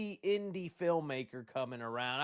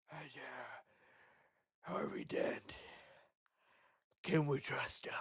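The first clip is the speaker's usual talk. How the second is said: hushed suspicious whisper; ominous whisper; 5 semitones lower, 11 dB quieter than usual. Pretend. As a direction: breathe it like a dark secret. Batman-style grim aside.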